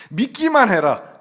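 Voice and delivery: loud, high, drawn-out command; commanding proclamation. Acted. Jesus urging the sisters to believe, ringing command. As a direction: proclaim it slowly at full voice.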